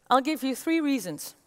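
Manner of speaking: normal talking voice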